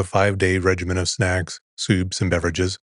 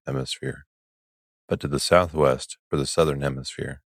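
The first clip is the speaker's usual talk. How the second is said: low, unhurried sleep-podcast narration; soothing bedtime reading; 4 semitones lower than usual. Acted. Host reads an article to bore listeners to sleep, soothing. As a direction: read it low and soothing, sinking at each sentence end.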